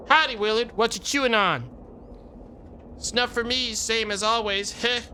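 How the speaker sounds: put-on high old-timer cowboy; chatty old prospector voice. Cowboy character Gabby voiced.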